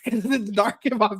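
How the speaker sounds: ordinary talk, nothing marked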